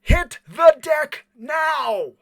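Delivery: slow, high, full shout; desperate warning. Acted. Operator's last warning before the fist flies, desperate shout. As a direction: bellow each word as a last desperate warning. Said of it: "calls out one last desperate warning".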